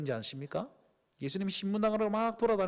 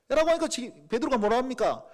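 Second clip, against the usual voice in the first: ordinary delivery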